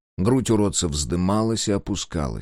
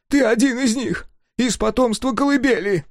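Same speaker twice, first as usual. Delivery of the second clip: high, eager little man's voice; excited old engineer. A narrator's reading. Engineer gloating at Sega, high and eager. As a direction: crow it out high and thrilled.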